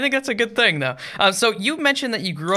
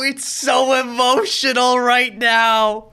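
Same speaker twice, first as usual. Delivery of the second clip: high, loud, slow mock sob; hammed-up blubbering through tears, dragging the words; mock sobbing; 8 semitones higher, 6 dB louder, much slower than usual. Telling his partner why he's crying at anime, mock sobbing. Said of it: "she'll see me crying".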